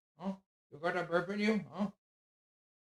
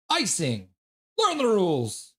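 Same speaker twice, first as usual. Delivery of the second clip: louder, higher outraged shout at the officials; angry yell at ref; 5 semitones higher, 11 dB louder, slower than usual. Real, not acted. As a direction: scold the refs for a bad call. Yelling at referees over icing.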